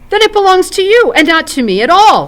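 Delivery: amazed outburst, high and loud; amazed cry. Acted. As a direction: blurt it out, stunned and leaping up. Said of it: "cried Frodo in amazement".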